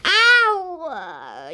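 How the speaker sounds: sharp yelp of pain. Acted. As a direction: yelp it, sudden and high. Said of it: "yelped Loon".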